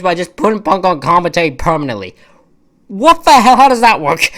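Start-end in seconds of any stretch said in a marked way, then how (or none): 2.9-3.6 loud, high outburst of disbelief; outraged. Real, not acted. Vlogger reacting to Punk joining commentary, loud outrage. 3.6-4.3 exasperated disbelief. Real, not acted. Disbelief at booking.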